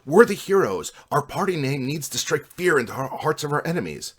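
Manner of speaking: loud, high heroic proclamation; bold boasting. A narrator's reading. Buddy defends the aggressive party name, bold.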